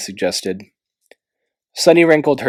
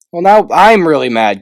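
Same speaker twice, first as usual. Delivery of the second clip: louder, higher huffy retort; huffing; 6 semitones higher, 7 dB louder than usual. A narrator's reading. Clay huffing at Glory's trick, louder. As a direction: huff it out. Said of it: "Clay huffed".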